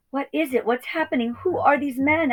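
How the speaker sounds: high, worried piglet voice; a small pig alarmed, asking fast; anxious piglet. Acted. Frightened piglet questions Ma.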